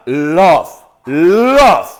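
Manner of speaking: "Love" with a long L and a final V sound. Shown as a demonstration. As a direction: draw out "Love", leaning on the L. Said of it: "Con V pequeña". ("Love" alargado, con V final)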